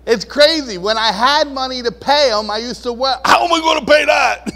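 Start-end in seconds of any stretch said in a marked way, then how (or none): 2.9-4.5 high, loud worried cry; old panic. Retold or imitated. Pastor mimicking his past self over bills, panicked shout. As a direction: blurt out the old money panic.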